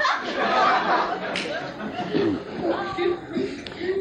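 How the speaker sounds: breathy high cackle; wheezy laugh. A narrator's reading. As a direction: laugh without voice. After jeering the prisoners.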